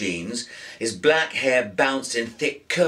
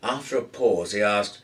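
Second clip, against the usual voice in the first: ordinary delivery, nothing marked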